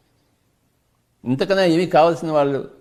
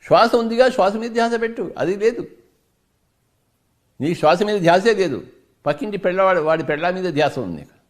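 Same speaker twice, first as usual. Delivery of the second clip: preaching at full force; 4 semitones higher than usual. Acted. Teacher scolding a listener who cries over nothing, fervent preaching. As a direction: hammer home what they already have.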